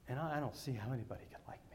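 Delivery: whispered, broken confession; tearful whisper. Retold or imitated. Boy confessing to his pastor at camp, tearful whisper. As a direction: hush it like a boy close to tears. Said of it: "He's just, just bawling".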